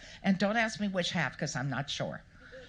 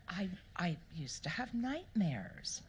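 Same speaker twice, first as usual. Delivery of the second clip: ordinary delivery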